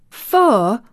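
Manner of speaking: taken aback, exclaiming; astonished cry. A narrator's reading. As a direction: cry it out in disbelief. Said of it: "cried out Martha".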